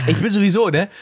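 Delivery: normal talking voice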